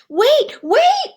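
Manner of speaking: high, loud call to the bus; yelling. Acted. Froggy shouting for the bus to wait. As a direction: shout after the leaving bus. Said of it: "he yelled".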